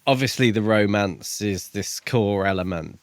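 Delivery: ordinary talk, nothing marked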